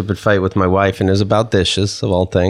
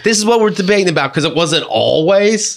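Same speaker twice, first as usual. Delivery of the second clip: exasperated argument; 8 semitones higher than usual. Retold or imitated. Repeating his side of fight.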